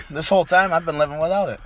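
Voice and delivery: usual speaking voice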